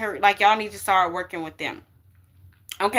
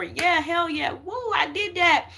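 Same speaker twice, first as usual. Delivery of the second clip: pitched up, whooping in triumph; mock celebration; 7 semitones higher than usual. Viewers celebrating a big win, excited whooping. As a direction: cheer like you just won a big battle. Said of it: "when you get over".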